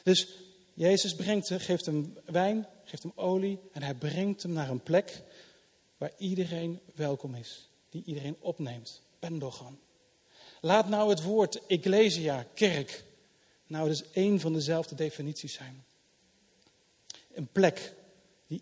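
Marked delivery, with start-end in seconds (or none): none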